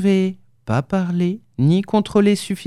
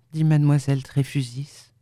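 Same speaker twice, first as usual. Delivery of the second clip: plain reading voice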